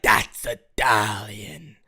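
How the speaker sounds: low, drawn-out mimicry of a TikTok guy; TikTok Italian guy impression. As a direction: stretch it out slowly in a deep put-on voice. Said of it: "then he'd be like".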